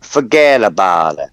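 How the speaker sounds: low, slow mock New Yorker; mock New York accent. Man mimicking the New York accent she likes, mock New York accent. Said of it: "New York accent".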